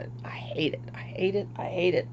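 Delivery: hushed, fed-up whisper; whispered frustration. Real, not acted. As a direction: whisper it, worn down. Venting about fibro pain.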